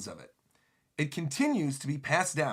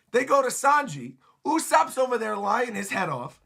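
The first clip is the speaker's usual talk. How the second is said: normal talking voice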